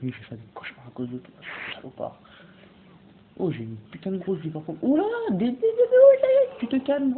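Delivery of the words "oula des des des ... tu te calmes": very high, loud stuttering alarm; panicked stammer. Real, not acted. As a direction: stammer and yell as danger jumps out. Alarmed by an attack. (bégaiement paniqué, crié très aigu)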